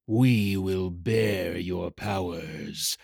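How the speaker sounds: low, very slow, soft menacing creature; ominous shadow rider. Acted. Dark rider looms over the terrified hatchling, ominous and slow. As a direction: stretch each word out, deep and hushed.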